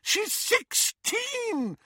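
high, very slow cry; shouting. A narrator's reading. Mother crying out about her daughter's age, high and slow. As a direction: wail it high and long. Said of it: "shouted Missus Weasley".

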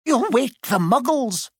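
high, sharp schoolmistress reprimand; stern teacher. Acted. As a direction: scold him sharply, pitched high. McGonagall scolds Hagrid.

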